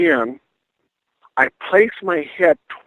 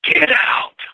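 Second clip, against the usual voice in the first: ghostly man's command; 7 semitones higher than usual. Retold or imitated. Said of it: "it's a man's voice, and he says".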